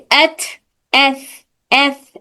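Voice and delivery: drawn-out hissing s sound for ایس; teacher's sound demo. Shown as a demonstration. Teacher demonstrating ایس to students. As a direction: hiss ایس as a long airy s. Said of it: "ان میں اتنا ایئر فلو نہیں ہوتا".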